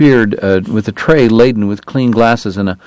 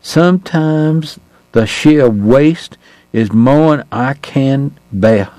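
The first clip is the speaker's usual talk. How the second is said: put-on very slow, higher, heavily drawled Southern accent with more'n; thick Southern accent; 4 semitones higher, slower than usual. Narrator imitates Doc for the puzzled Austrian, thick Southern accent. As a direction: thicken the Southern drawl so a foreigner can't follow. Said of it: "his accent even harder for a foreigner".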